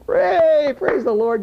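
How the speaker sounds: high, loud cry of hooray; joyful shout. Retold or imitated. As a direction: shout it out like a cheering crowd. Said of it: "the whole group just didn't say".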